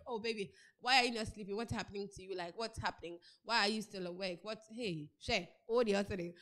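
a suitor softly sweet-talking a lonely wife; mock sweet talk. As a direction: coo it like a new boyfriend at night. New lover sweet-talking the woman.

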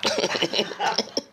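high helpless cackle; laughing. Real, not acted. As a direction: burst out laughing at your own punchline. Laughing at own story.

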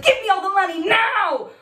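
high, loud mock stickup yell; a hammed-up robbery shout; crazed robber. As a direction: play a wild-eyed gunman. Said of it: "made my eyes go all crazy".

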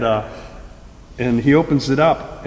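ordinary talk, nothing marked

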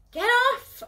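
very loud high yell; roar. Acted. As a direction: roar it, lashing out. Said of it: "I roar".

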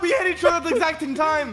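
high, fast, jumbled protest; garbled rush. Real, not acted. Player protests a near tie, garbled rush. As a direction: blurt it all in one high, slurred rush. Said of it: "I don't speak enchantment table".

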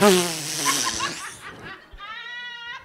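high squealing laugh; real joyous cackle; high laughter. Real, not acted. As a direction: burst into helpless high laughter. Overcome with holy laughter.

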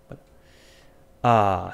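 ordinary talk, nothing marked

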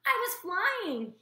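high, slow, squeaky gosling; proud little bird squeak; squeaky and thrilled. Acted. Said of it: "he squeaked".

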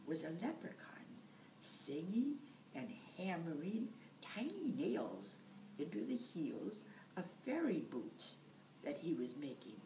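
usual speaking voice

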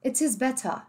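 "It is better" with crisp Ts, no final R; British T. Shown as a demonstration. Teacher echoing the British example, crisp T in "better". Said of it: "em inglês britânico".

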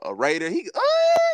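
loud burst of excitement, pitch leaping high; hyped shout. Real, not acted. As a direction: cry out in sudden joy about the player. Hyped about Brock Bowers on the Raiders.

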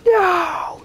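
high shrill-free anguished no; mock anguished cry. Streamer imitates Claire crying out when the man dies, very high. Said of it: "she's like".

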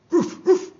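high, loud barking roof roof; dog bark. Acted. Man's dog answering the bartender, barking high.

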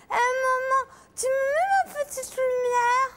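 put-on high whimpering little girl; whiny child. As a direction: whimper and plead like a tired kid. Child whimpering, calling mother. (voix d'enfant pleurnicharde)

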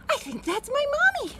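high, excited bunny voice; high-pitched childlike excitement; excited little bunny. A narrator's reading. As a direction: pipe up like a hopeful little bunny. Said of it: "He says".

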